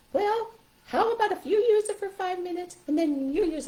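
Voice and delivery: high puppet voice making a suggestion; high-pitched animal helper; stuffed animal voice. Shown as a demonstration. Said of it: "kind of guide them through it through the animal".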